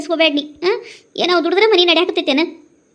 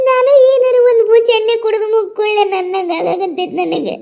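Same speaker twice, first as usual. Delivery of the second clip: drawn-out, slow tearful lament; despairing cry, words stretched through tears; sobbing wail; 5 semitones higher, slower than usual. Acted. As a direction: wail it out between heaving sobs. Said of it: "ನೀನ ಹಿಂಗೆ ಅಳಾಕತ್ತಿ".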